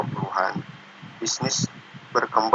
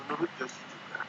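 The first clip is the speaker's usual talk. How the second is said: choppy dropouts on a bad line; bad connection; 3 semitones higher, 11 dB quieter than usual. Real, not acted. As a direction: voice cutting in and out. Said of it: "Maaf, suaranya nggak kedengaran".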